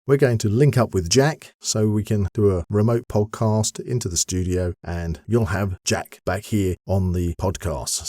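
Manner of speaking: usual speaking voice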